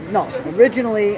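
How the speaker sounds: ordinary talk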